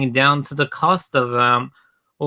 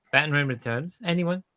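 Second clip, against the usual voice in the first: ordinary delivery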